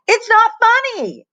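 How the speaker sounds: very high, loud holler. A narrator's reading. Ashley yelling at Lenny, hollering. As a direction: shout back at the teaser. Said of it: "hollered Ashley".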